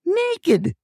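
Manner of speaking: high shouted Naked!; shrill-free high yell of Naked!. Retold or imitated. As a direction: cry Naked! up high and falling. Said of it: "She's saying".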